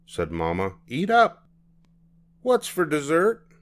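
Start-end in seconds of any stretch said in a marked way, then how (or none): 0.9-1.5 mother voice. Acted. Mama voiced. 2.4-3.5 put-on child voice. Child asks Mama.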